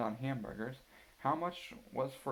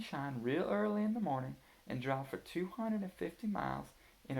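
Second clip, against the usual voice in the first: lightly higher voice of a planning mother; Mama; 8 semitones higher than usual. A narrator's reading. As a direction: read it as Mama explaining the trip, a touch higher. Mama reads trip plan.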